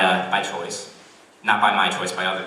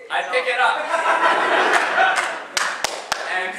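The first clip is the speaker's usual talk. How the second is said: laughing; 13 semitones higher, much slower than usual. Real, not acted.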